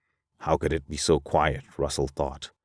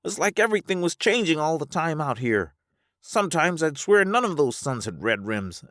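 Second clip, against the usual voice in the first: high character voice, easing down at the end; high-voiced Alvar, bewildered; 11 semitones higher than usual. Alvar describing the changing suns, high bewildered voice. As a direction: wonder aloud in a high register.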